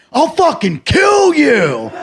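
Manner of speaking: high, drawn-out mad scream; crazed threat. Retold or imitated. Driver to man through rolled-down window, crazed drawn-out yell. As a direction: erupt into a slow, high-pitched yell.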